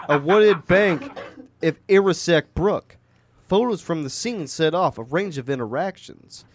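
put-on Italian-American gangster accent; Tony Soprano. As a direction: read the news like an Italian-American mobster. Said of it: "Tony Soprano".

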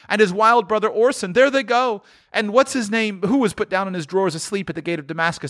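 high, overexcited gushing; carried away by childhood memories, pitch soaring; earnest, excited rush in a high voice; between laughing and crying. A narrator's reading. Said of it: "a most extraordinary voice between laughing and crying".

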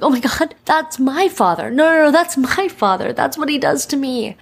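high, excited replay of her own reaction; excited realization. Retold or imitated. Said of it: "I'm like".

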